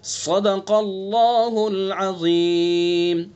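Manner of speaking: slow chanted recitation, खन्नास long; Quran recitation. Shown as a demonstration. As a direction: recite with खा full-mouthed. Recites the verse. (धीमी तिलावत)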